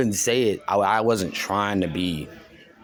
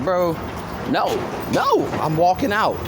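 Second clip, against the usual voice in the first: refusing bro; 8 semitones higher than usual. Retold or imitated. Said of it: "you're like".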